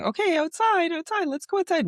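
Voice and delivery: high sing-song voice for a pet; sing-songy dog voice. Retold or imitated. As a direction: coax the dog out in a high singsong. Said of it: "my sing-songy dog speaking voice".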